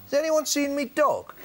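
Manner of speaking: put-on a lost drunk asking the room; lightly hammed-up drunk punter at a gig; blind drunk guy. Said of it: "he went".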